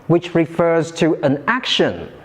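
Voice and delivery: ordinary delivery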